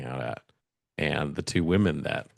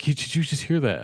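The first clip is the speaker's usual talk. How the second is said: retold jumpy question, pitched up; mock alarm, higher voice; startled; 7 semitones higher than usual. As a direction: imitate a friend urgently checking a strange noise. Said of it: "all of a sudden you just jump".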